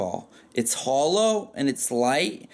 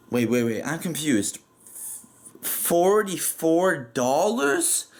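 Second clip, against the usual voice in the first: mock confusion building to a drawn-out incredulous question; hammed-up bafflement, stretching out the price; overdramatic disbelief; slower than usual. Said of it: "over dramatically".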